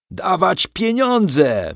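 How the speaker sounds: high, drawn-out yell. A narrator's reading. Soldiers demand money outside the house, yelling. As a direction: bellow the demand, stretching the words. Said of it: "wrzeszczą".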